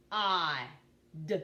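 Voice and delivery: "P-I-D" as three separate sounds, p, ah, d; sounded out. Shown as a demonstration. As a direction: sound out "P-I-D" with gaps between. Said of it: "Tell me the sounds in pod".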